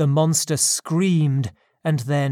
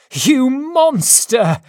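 loud, much higher, slow shout; 9 semitones higher, 7 dB louder, slower than usual. A narrator's reading. Victor shouting at the monster, loud high shout. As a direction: scream it at him. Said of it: "I shouted at him".

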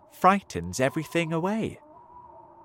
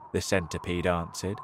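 usual speaking voice

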